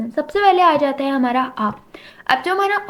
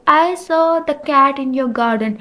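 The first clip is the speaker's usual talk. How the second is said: slow dictation; much slower than usual. Shown as a demonstration. Reading example sentence slowly.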